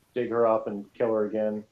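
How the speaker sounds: ordinary delivery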